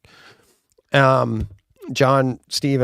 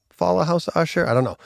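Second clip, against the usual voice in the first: ordinary talk, nothing marked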